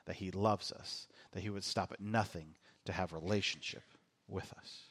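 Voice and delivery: low, soft and slowing reverence; hushed, earnest. Real, not acted. Preacher telling church what Christmas is about, hushed and earnest. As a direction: let it sink to a near-hush.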